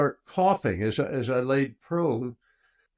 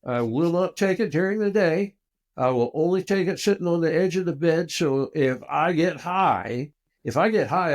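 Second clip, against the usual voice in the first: retold firm ultimatum, higher pitch; insistent conditions; 5 semitones higher, 3 dB louder than usual. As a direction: lay down terms emphatically, pitch raised. Retelling his firm conditions to wife.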